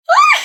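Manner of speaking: sudden high piercing yell of rage; frustrated scream. Acted. Betrayed friend screaming out her rage. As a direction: let out a furious scream.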